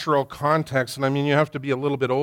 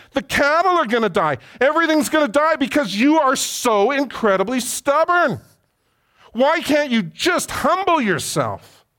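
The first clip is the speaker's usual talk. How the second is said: much higher and louder, climbing rage; furious rebuke; 9 semitones higher, 3 dB louder than usual. Retold or imitated. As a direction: Moses warning Pharaoh of disaster. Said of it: "And Moses says".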